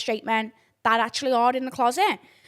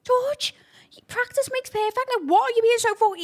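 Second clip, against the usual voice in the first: exasperated scolding; 12 semitones higher, 4 dB louder than usual. Retold or imitated. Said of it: "I say".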